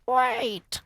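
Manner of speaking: high, loud witch shriek. Acted. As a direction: shriek it to halt everyone. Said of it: "shrieked one of the witches".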